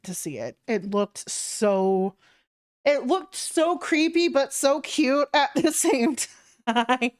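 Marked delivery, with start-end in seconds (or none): 2.8-7.1 excited. Real, not acted. Gushing about the art.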